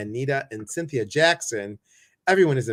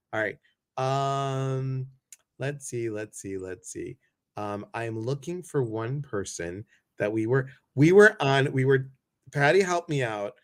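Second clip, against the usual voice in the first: normal talking voice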